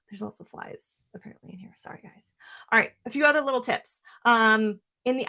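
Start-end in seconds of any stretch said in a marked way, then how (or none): 0.1-2.3 whispered apology; hushed aside to viewers. Real, not acted. Aside about flies in office.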